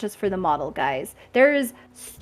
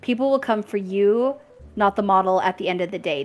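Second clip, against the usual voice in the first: normal talking voice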